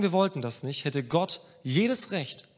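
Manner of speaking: ordinary talk, nothing marked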